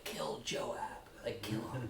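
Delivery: hushed, barely audible imagined order; deathbed whisper. Acted. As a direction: whisper it like a dying man's secret command. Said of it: "I just imagine him whispering".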